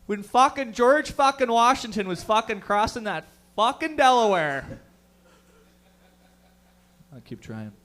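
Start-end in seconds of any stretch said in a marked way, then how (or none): 0.1-4.8 put-on Canadian hockey bro accent. Hockey player recounting history.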